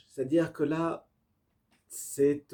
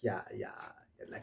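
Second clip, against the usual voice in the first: hushed, secretive whisper of "il y a, il y a"; a teasing whisper before the reveal; 5 semitones lower, 12 dB quieter, much faster than usual. Acted. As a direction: breathe it like a secret. Teasing build-up to creativity.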